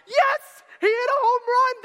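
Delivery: high, loud excited cheer; cheering fans. Retold or imitated. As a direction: yell it like a fan seeing the replay. Fans cheering at a home run.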